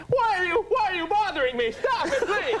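very high, agitated comic pleading; excited, hammed-up impression of a pestered man; Adam Sandler. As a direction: whine it up high, all worked up. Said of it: "and then he gets excited".

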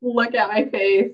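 ordinary delivery

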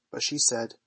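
plain reading voice

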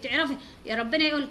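usual speaking voice

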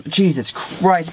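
ordinary delivery

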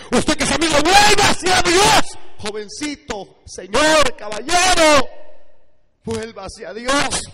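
loud, high preacher's appeal; full-force altar call. Real, not acted. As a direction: cry out to sinners, stretching the key words.

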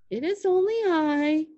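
high goat voice; high, sustained creature voice; middle-sized billy goat. A narrator's reading. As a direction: answer meekly as a storybook goat. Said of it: "said the middle-sized billy goat".

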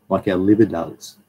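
ordinary talk

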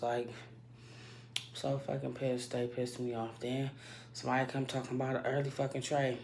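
ordinary talk

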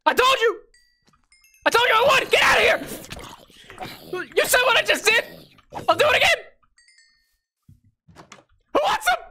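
high-pitched triumphant shouting; hammed-up, squealing bravado; frantic mock threats. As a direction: crow wildly at a beaten opponent. Crowing after attacking in game.

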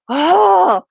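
high, loud "oh" of delight; awestruck. Real, not acted. Showing viewers the plank paper, awestruck. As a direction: let out a high, marvelling cry.

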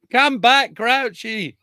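high, loud hammed-up cry across the field; mock call to Grouchy. Napoleon begging Grouchy to return, hammed-up loud call. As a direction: call him back like a desperate Napoleon, laughing. Napoleon calling to his marshal.

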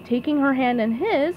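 usual speaking voice

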